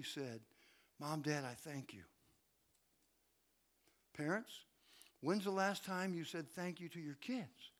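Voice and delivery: hushed, low, earnest appeal; soft reflective preaching. Real, not acted. Preacher asking families when they last gave thanks, softly reflective. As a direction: drop right down and let each question sit.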